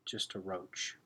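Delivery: ordinary delivery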